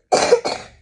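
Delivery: sudden loud acted cough. A narrator's reading. Enemy hidden under a bush, sudden loud cough. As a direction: burst of voiceless coughing.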